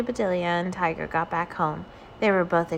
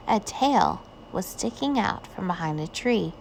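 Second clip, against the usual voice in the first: ordinary delivery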